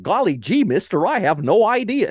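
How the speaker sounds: high, bright, dopey gee-whiz voice; clueless yokel voice. Retold or imitated. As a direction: play him as a wide-eyed bumpkin. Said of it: "And Seneca's just like".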